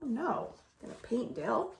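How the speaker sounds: ordinary delivery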